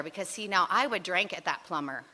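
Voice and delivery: usual speaking voice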